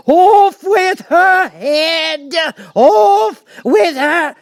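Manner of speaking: shrieked, very high and slow; screaming Queen. A narrator's reading. The furious Queen at Alice, a full shrieking scream. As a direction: scream the sentence out in pure fury. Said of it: "began screaming".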